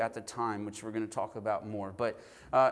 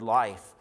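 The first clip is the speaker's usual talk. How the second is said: "life" set apart, loud and falling; stress on LIFE; 2 semitones lower, 5 dB louder than usual. Shown as a demonstration. Preacher emphasizing the last word of the verse, loud stressed "life". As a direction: land firmly on "life". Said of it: "think and emphasize the word".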